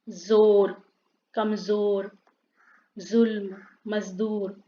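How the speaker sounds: जोर, कमजोर, जुल्म said with a clear z instead of j; teacher modelling nukta words with a z sound. Shown as a demonstration. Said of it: "जेड होगा".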